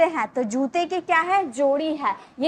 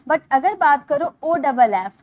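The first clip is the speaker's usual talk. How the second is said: normal talking voice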